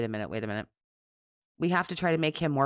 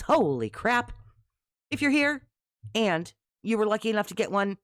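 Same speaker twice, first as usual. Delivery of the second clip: ordinary talk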